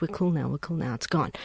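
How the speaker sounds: soft, low all-clear after the threat; calm reassurance. Acted. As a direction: softly settle the baby down. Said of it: "It's like".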